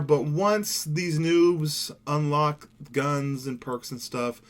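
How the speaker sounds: ordinary talk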